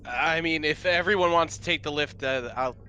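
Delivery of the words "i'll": ordinary delivery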